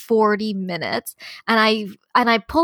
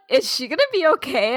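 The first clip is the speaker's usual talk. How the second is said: higher, a hint of a fretting staffer; worried cast member; 5 semitones higher than usual. Friend imitates Disneyland cast member asking about crier, lightly worried. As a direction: gently voice the anxious staffer. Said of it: "'Cause he's just like".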